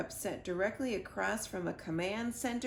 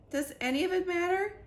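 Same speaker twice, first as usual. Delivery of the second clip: much higher, louder; despairing question; 10 semitones higher, 5 dB louder than usual. A narrator's reading. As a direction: cry out hopelessly to the dog. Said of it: "I asked Freddie".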